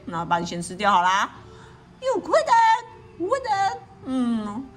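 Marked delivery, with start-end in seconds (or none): 2.0-3.8 high squeaking mouse voice, louder; scared mouse squeaking at bear; squeaky mouse. A narrator's reading. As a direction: squeak it out in panic. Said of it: "squeaked the mouse".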